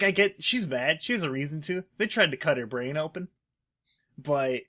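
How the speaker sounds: ordinary delivery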